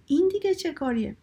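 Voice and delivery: storyteller's mild yell; shouted protest. A narrator's reading. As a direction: cry out in mock exasperation. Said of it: "فریاد زد".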